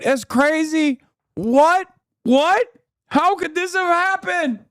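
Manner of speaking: very high, squealed fake disbelief; mock shock. Streamer feigning surprise at old news, hammed-up mock shock. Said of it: "let me tell you how shocked I am".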